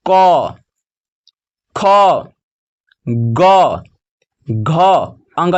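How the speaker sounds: each letter alone and drawn out; teacher reciting the consonants, long pauses between; slow letter-by-letter drill; alphabet recitation. Shown as a demonstration. Reads out consonant chart.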